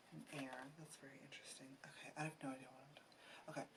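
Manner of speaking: very soft, near-whispered thinking aloud; muttering to self. Real, not acted. Reader puzzling over water and air, whispering to self. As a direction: trail into a barely voiced private aside.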